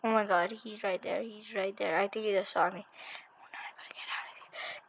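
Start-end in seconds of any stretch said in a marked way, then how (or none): 2.9-4.9 hushed, barely voiced whisper; anxious whisper. Real, not acted. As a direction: whisper it as if hiding from someone close. Hiding from seeker in game.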